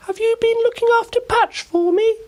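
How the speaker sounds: put-on a bright little girl introducing herself; Ruby smiling. A narrator's reading. Ruby greeting Oliver, very high cheerful girl voice. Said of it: "the girl said".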